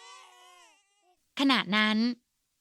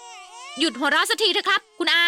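irritated plea; 7 semitones higher, 5 dB louder, slower than usual. Acted. Annoyed plea to uncle.

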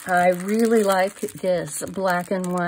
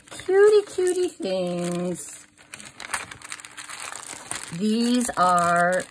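cutesy gushing; 3 semitones higher, much slower than usual. Real, not acted. Showing off her cute little purchases, cutesy and drawn out. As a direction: coo over cutie little things.

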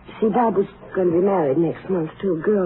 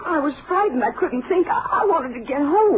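higher, tearful defense; upset daughter answering the ranger; upset pleading; 6 semitones higher than usual. Acted. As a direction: plead that fear took over. Defends not stopping, upset.

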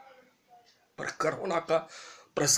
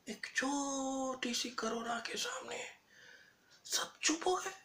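hushed, breathy, much softer; whisper; 9 semitones higher, 10 dB quieter than usual. Real, not acted. Stunned at tiny virus, whispering. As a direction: whisper it like a secret. Whispered against the loud lines.

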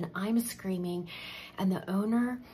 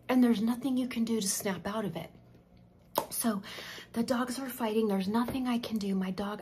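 usual speaking voice

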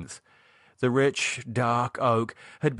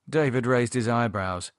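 plain reading voice

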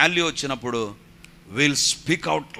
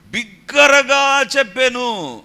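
slow, loud, high proclamation; loud declaration; 9 semitones higher, 7 dB louder, much slower than usual. Shown as a demonstration. Preacher showing how the father said it, loud cry. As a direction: draw it out and ring it out loudly.

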